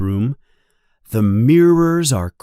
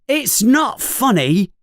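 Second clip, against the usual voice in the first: higher-pitched mild protest; upset cry; 12 semitones higher than usual. A narrator's reading. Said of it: "cries Ron".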